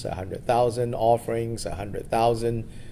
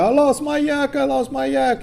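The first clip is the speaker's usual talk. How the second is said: put-on high, wailing child voice; panicky boy; 7 dB louder than usual. Nomad kid cries to Milarepa about his yak, high frantic wail.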